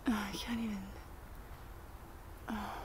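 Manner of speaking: breathless low whisper. Real, not acted. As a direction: whisper it, overwhelmed with delight. Overwhelmed by the bird.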